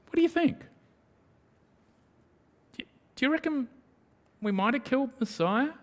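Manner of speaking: high, halting voice of puzzled townsfolk; tentative wondering. Acted. Townsfolk debating at the meal table, tentative and wondering.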